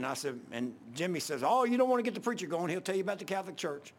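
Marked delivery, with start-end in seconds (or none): 1.4-3.9 high, quick egging-on voice; goading. Retold or imitated. Coworker egging on the older guys, goading. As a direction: stir them up with a fast, high warning.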